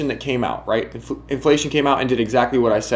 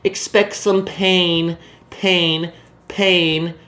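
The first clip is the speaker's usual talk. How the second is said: slow, drawn-out mock alarm; mock fearmongering headline voice; mocking panicky headlines; 3 semitones higher, much slower than usual. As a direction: sneer at the doom headlines, stretching the words. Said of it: "all of the headlines".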